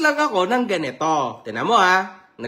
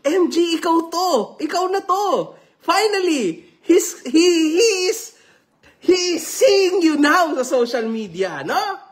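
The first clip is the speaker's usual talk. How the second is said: gushing, laughing fan; mock excited fan reading with laughs; excited fan quote; 6 semitones higher than usual. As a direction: read it like a giddy fan tagging you. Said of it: "nagtag sa akin".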